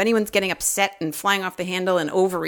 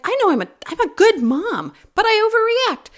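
mock pride; 4 dB louder than usual. Mock-proud retelling of own line.

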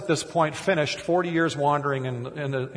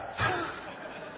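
breathy laugh; 9 dB quieter than usual. Real, not acted. Laughs at failing a word.

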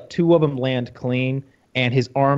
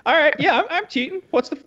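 much higher, hammed-up hurried voice; mock Brock Lesnar; 8 semitones higher than usual. Brock Lesnar paying his steroid fine, high and hammed-up. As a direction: rattle it off like a busy, cheerful cheat. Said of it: "Brock Lesnar as a careless cheater".

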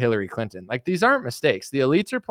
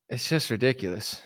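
ordinary talk, nothing marked

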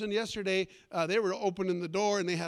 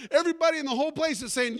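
normal talking voice

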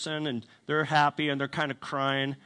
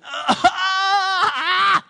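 very high, loud mock wail; imitated sobbing wail; 12 dB louder than usual. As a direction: mimic her sobbing as a piercing cry. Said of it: "She's sobbing".

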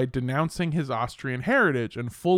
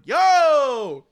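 loud, high-pitched protest; appalled reaction; 10 semitones higher, 6 dB louder than usual. Retold or imitated. Host re-enacting shock at his research, appalled outburst. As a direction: cry out as if begging it to stop. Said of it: "like, my genuine reaction to it".

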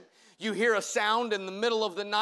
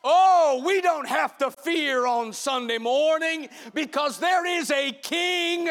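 preaching at full force; 5 semitones higher, 4 dB louder than usual. Acted. Preaching climax with shouts.

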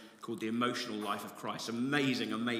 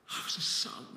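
awed whisper. Real, not acted. Emotional whisper about adoption.

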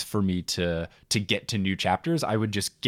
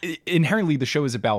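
much higher and louder, insistent; emphatic; 10 semitones higher, 6 dB louder than usual. Real, not acted. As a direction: push the point hard and fast. Emphatic justification.

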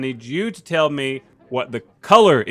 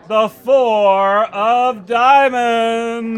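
grand reveal; 7 semitones higher, much slower than usual. Pretend. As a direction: draw it out like a magician. Magic trick reveal.

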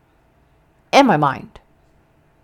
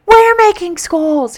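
excited; 10 semitones higher, 5 dB louder, slower than usual. Retold or imitated. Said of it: "And I'm like".

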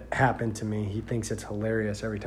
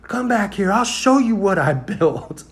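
higher, louder mocking drunk taunt; 9 semitones higher, 9 dB louder than usual. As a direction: jeer like a drunk showing off his mess. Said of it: "And he go".

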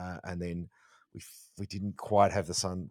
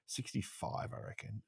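muttered aside; 3 semitones higher, 10 dB quieter than usual. Retold or imitated. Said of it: "and he's like".

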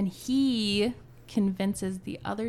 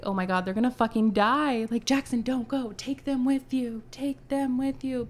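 yelling; 4 semitones higher, 8 dB louder than usual. Retold or imitated. Yelling at character in story.

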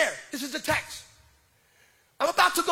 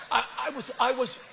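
usual speaking voice

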